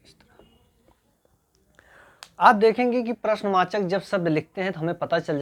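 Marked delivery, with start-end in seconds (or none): 0.1-0.5 whisper. Real, not acted. Soft aside while writing.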